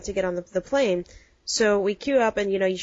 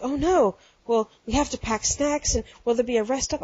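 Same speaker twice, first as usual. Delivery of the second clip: fretful panic; 4 semitones higher than usual. Retold or imitated. Hagen fretting over short drive.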